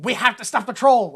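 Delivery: retold yelling. Townspeople demanding action from the sheriff, retold yelling. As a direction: raise it into an imitation of a crowd's demand. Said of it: "yelling at the sheriff".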